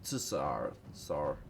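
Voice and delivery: light Russian-accented "Tsar". Shown as a demonstration. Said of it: "If I go to, like, a Russian accent".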